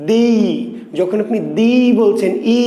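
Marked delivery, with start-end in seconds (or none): 0.1-0.3 "দি" as one long syllable; merged vowel. Shown as a demonstration. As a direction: draw both ই into a single held "দি". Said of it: "তখন দুটো ই উচ্চারণ করছেন একসাথে".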